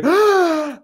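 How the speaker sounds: high sharp mock gasp of a kid; excited gasp. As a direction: gasp as if the pack holds treasure. Kid opening card pack.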